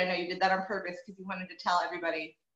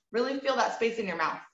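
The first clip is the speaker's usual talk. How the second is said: ordinary talk, nothing marked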